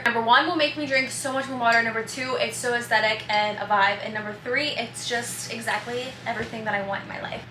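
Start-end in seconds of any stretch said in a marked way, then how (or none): none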